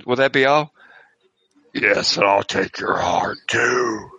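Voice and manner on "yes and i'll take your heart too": raspy voice through a speaker; drive thru speaker. Acted. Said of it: "a raspy voice saying".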